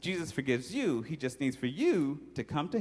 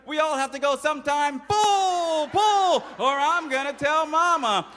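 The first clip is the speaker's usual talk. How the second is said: high, loud bossy command; bossy sister's shout; 12 semitones higher, 6 dB louder, slower than usual. Acted. Little sister shouting orders at her brother, loud and high. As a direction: yell the orders.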